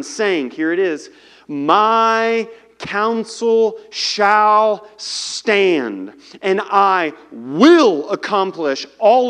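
slow, loud solemn Bible reading; booming scripture reading from the pulpit. Acted. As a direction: read it out with weight, like the voice of God. Preacher reads scripture aloud.

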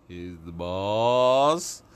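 very slow, drawn-out "boss"; slow, self-important swagger; bossy main guy. Acted. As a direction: stretch "He's the boss" way out with a rising end. Voicing his swaggering main-guy personality.

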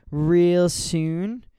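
words around a sip; talking mid-sip of coffee; while sipping a drink. Real, not acted. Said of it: "Sorry, I'm drinking".

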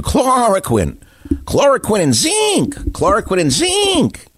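high, slow, sing-song mock of a TV doctor; sneering high-pitched impression of a TV pundit; mocking TV clown. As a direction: parrot the clown's slogan with scorn. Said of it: "a T V clown who was telling you".

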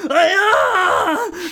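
high yelping scream; startled scream. Acted. Trent startled by the mud girl, high yelping scream. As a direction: jump out of your skin. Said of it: "screamed Trent".

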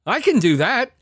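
high, loud indignant retort; angry outburst. Retold or imitated. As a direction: re-enact an incredulous, heated outburst. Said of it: "I was so angry".

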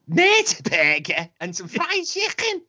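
hammed-up Scouse put-on; false Scouse accent. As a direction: drag the words out in mock Scouse. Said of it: "this false Scouse accent".

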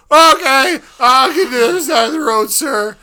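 scared driver answering the trooper; panicking. Retold or imitated. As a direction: blurt your obedience in a panic. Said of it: "So I'm panicking at this point".

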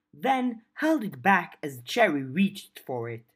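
plain reading voice

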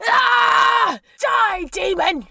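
high, drawn-out war cry; high furious yell at an enemy; battle cry. Acted. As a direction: scream it as you charge. Captive charges to ram demon.

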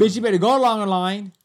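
loud, bossy telling-off; bossy scolding. Pretend. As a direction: order them back in line. Mock scolding a shopper.

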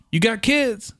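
high-pitched imitation of a young boy; little kid's accent and voice. Eight-year-old boy at the door asks speaker, imitated kid voice. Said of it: "the accent and voice that he had".

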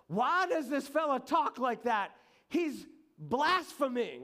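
high, loud mock indignation; outraged. Teachers of the law accusing Jesus of blasphemy, outraged. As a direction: act out an affronted accuser, pitched right up.

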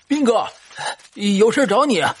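high calling shout from afar; shouting while running. Acted. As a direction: call out to him while running up. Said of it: "一边跑一边喊".

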